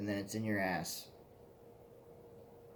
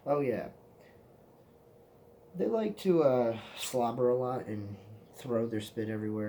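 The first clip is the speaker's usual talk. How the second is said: lightly voiced careless friend; buddy's excuse; 2 semitones higher, 7 dB louder than usual. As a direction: imitate the friend casually excusing the dogs. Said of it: "And he's like".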